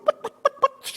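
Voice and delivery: imitated putting sound; put-put of a turkey running off; fleeing turkey noise. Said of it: "They're gonna go".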